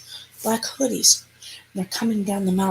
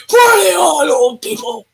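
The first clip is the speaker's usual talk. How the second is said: high, loud imitation of rowdy kids; mock party yell; 8 semitones higher, 7 dB louder than usual. Kids hollering to the old people, mock party yell. Retold kids yelling at older crew.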